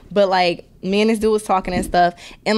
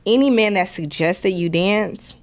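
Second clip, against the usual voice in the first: ordinary talk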